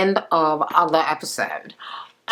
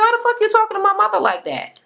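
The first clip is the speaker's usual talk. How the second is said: high, fast indignant demand, imitated; not having it; faster than usual. Sister confronting her over talking to mother, indignant. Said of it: "was not here for that shit".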